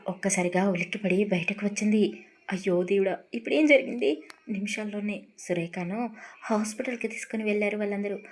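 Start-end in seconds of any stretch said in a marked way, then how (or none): 2.5-4.1 louder, rising panic; panicked cry. A narrator's reading. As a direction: gasp out in alarm. Sudden shock at collapse. (భయంతో కేక)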